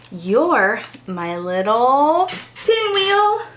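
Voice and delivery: normal talking voice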